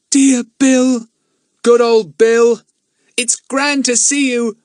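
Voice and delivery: put-on high, loud, drawn-out children's cheer; joyful greeting. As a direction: welcome a dear friend with squealing delight. Children greet their friend joyfully.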